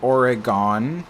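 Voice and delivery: "Oregon" drawn out with a long final GON like "gone"; Oregon, out-of-state way. Shown as a demonstration. Viewer asks about the mispronunciation, "Oregon" said with an open "-gone". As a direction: say "Oregon" slowly, last syllable a full "gone". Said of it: "when people pronounce Oregon as".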